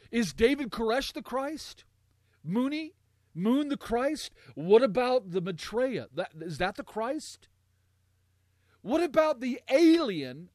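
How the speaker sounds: rising mocking questions; incredulous. Real, not acted. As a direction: scoff at each false Christ, voice high.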